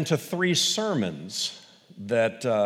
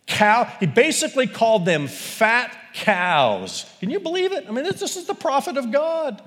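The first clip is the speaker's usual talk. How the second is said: much higher and louder, disbelieving; mock outrage; 11 semitones higher, 5 dB louder than usual. Real, not acted. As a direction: act scandalized at the prophet's words. Preacher aghast at the insult.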